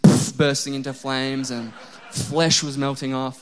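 telling about the scorching weekend, slow laughing ham; laughing exaggeration. Exaggerated heatwave tale.

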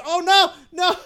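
high, laughing yelp of dismay; laughing panic. Retold or imitated. Buddy realizing the boneless wings burn too, laughing high. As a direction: squeal it out through laughter. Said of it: "He goes".

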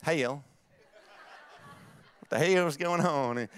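Southern US accent on "hell". Shown as a demonstration. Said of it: "It's my southern, southern accent".

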